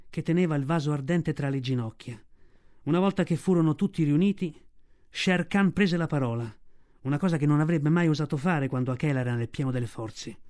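ordinary delivery

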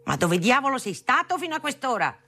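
high-pitched scolding outburst; father yelling. A narrator's reading. Father to son back late at night, angry yell. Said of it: "abituato a urlare così".

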